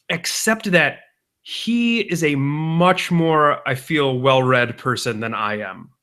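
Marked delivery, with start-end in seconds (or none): none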